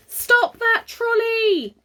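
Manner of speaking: high, slow loud call. A narrator's reading. Father calls from his car to stop the trolley. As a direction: call after the runaway trolley. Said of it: "called Father out of the window".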